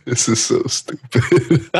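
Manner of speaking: slow words broken by laughter; cracking up while talking; real laughing. Real, not acted. Friend giving up on the bit, real laughter.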